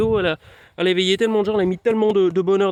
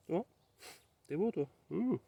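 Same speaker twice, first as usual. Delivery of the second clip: tender murmur to the post; 15 dB quieter than usual. Real, not acted. Fondly talking to the post.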